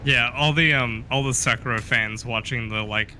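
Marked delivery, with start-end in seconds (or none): none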